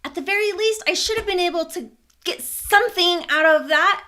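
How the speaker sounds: high, loud indignant venting; retold anger. Venting to her sister about the money, angry. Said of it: "'cause I was so angry".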